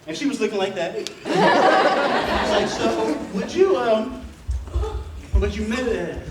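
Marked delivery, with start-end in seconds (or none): 1.3-6.2 laughing. Retold or imitated. Retelling his nervous proposal.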